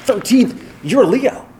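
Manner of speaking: high mock declaration of fate; imitating an eager colleague; excited friend. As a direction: announce the coincidence triumphantly. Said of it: "He's like".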